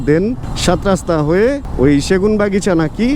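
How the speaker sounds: usual speaking voice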